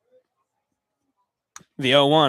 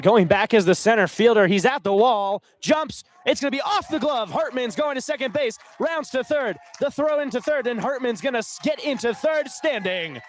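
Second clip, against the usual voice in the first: high-pitched, urgent call of a big hit; real sportscaster excitement, sustained high pitch; excited play-by-play; 7 semitones higher than usual. Real, not acted. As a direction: ride the play as it unfolds, voice up high. Live call of a triple.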